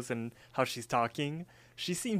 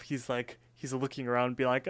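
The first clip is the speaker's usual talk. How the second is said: ordinary delivery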